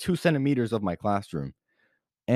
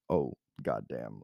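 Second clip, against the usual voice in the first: slow stunned mutter; 8 semitones lower, 8 dB quieter, slower than usual. Retold or imitated. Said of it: "the look in her face when she was just like".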